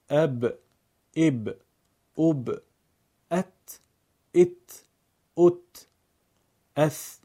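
short a, i, u each closed by the consonant, as in اب، ات، اث; sakin letter drill. Shown as a demonstration. Teacher drills learners: اب، ات، اث as short closed syllables. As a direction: chant short, separate syllables like اج and اح, pausing after each.

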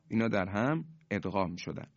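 usual speaking voice

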